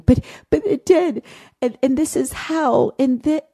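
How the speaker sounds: lightly voiced upset child insisting; imitating a begging little girl. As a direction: plead like a scared little girl. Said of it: "and just begging".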